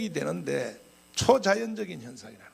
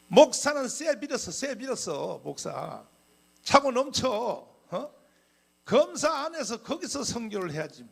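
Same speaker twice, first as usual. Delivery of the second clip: scolding pastor; 7 semitones higher than usual. Retold or imitated.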